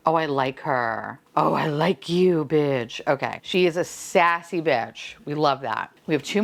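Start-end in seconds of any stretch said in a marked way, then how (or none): none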